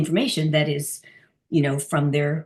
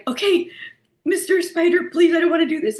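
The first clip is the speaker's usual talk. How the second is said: high, louder, nervous plea; pleading with spider; 10 semitones higher, 4 dB louder than usual. Retold or imitated. About to squish the spider, high nervous pleading. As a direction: sweet-talk the spider anxiously up high. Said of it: "and I was like".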